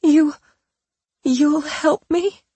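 high, slow, trembling hope; quavering hopeful. Pretend. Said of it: "let her voice quaver with hope".

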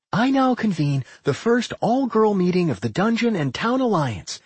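high, proud proclamation; happy declaration. A narrator's reading. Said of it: "she declared happily".

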